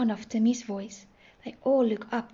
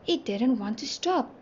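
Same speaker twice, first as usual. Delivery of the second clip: plain reading voice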